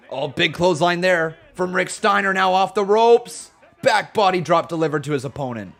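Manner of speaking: animated ringside commentary; wrestling play-by-play. Pretend. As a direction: call the action like a match announcer. Calls the wrestling match.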